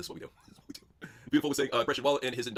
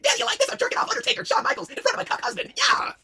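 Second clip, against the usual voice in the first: very high, loud lewd squeal; squealing wife; 5 dB louder than usual. Acted. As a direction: scream it out like a wild wife. High-voiced wife taunting husband.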